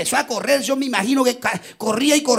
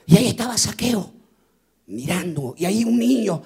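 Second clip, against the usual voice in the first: tender sermon narration; 9 semitones lower than usual. Acted. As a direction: draw them into the moment gently. Tender turn to Zacchaeus weeping.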